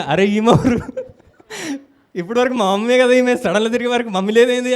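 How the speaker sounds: loud, startled cry of disbelief, half laughing; suddenly realising she is a stranger; shocked surprise. Retold or imitated. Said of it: "నేను shock అయినా". (ఆశ్చర్యంతో గట్టిగా)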